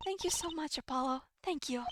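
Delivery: quiet, tender thanks; soft gratitude. Acted. Patient thanking Apollo at the clinic, softly grateful.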